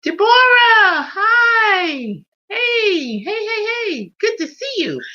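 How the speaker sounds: squealing high, drawn-out hello; high, excited greeting to a viewer; delighted greeting. Real, not acted. Greets a viewer joining live.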